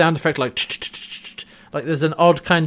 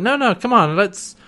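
higher-pitched coaxing; acted pleading; 6 semitones higher than usual. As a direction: coax someone who refuses to talk. John pleading with angry Eren.

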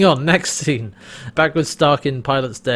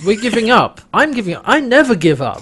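much higher, louder disbelief; rally with exaggerated sudden indignation; mock indignant realization; 8 semitones higher, 5 dB louder than usual. Host plays character refusing to give up, mock indignant.

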